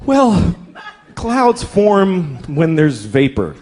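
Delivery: very slow, strained patient explanation; drawn-out explaining. Retold or imitated. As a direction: stretch it out, losing patience.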